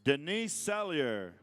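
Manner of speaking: slow, drawn-out name call; powwow emcee. Acted. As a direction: call the name out slowly to the crowd. Calling names at a powwow.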